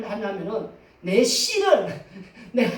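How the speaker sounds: ordinary talk, nothing marked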